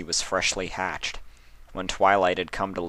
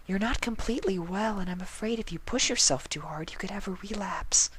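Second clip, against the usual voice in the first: soft, high, whispered shy pony; 14 semitones higher, 4 dB quieter than usual. A narrator's reading. Fluttershy urging sick Twilight to rest, soft high whisper. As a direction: whisper it gently, worried.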